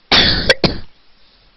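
loud hacking cough; a real rough cough from a sick chest; chesty cough. Real, not acted. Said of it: "No sorry, kaszel jest chory dzisiaj".

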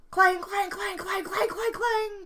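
high loud clanging; trolley bell clang. Acted.

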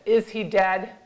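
loud, forceful question; retold insistent demand. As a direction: demand the answer, loudly. Asks again after no answer.

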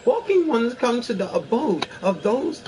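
high, airy fairy speaking formally; lilting fairy creature, aloof. Acted. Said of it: "my best fairy voice".

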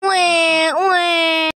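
high, drawn-out pretend baby wail; baby crying. Abandoned baby cries to be found, pretend baby crying. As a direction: imitate a baby's cry in long wahs. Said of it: "Is that a baby?".

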